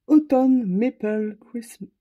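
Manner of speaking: reading out a colour name slowly and loudly, with a French accent; slow careful English. Real, not acted. A French crafter carefully reads the English paint name, slowly and loud. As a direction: Autumn Maple Cris- spelled out slowly and loudly in a French accent. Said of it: "On s'arrange pour mon accent ch'ti".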